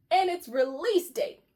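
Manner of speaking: ordinary delivery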